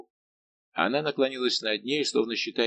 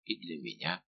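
much softer and lower, like a thought; unspoken reply; 5 semitones lower, 10 dB quieter than usual. A narrator's reading. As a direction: let it out very quietly, as if only a glance said it. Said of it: "говорил взгляд принцессы". (очень тихо, будто одним взглядом)

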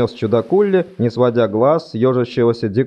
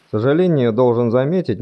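ordinary delivery

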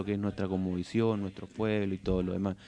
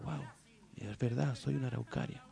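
soft wonder; 11 dB quieter than usual. Acted. Tree realizes who it is.

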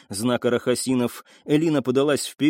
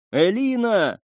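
calling out; 8 semitones higher, slower than usual. A narrator's reading. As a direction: call out for her through the smoke. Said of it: "позвал он".

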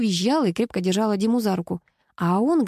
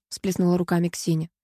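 usual speaking voice

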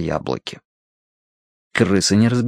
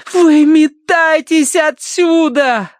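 slow, high, loud cry; exhaled shout; 5 dB louder, much slower than usual. A narrator's reading. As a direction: breathe it out slowly, drawn out. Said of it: "выдохнула Киврин". (выдохнула протяжно)

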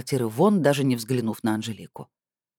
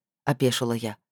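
ordinary delivery, nothing marked

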